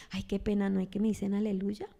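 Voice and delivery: a touch of bashful embarrassment; embarrassed excuse. Retold or imitated. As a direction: shrink away ashamed of the faith.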